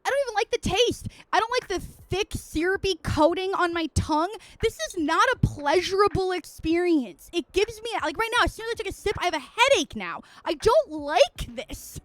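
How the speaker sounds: high, loud, emphatic complaining; exasperated rant. Real, not acted. Streamer venting to viewers about a hated drink, exasperated. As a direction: protest it hard, voice climbing as you go.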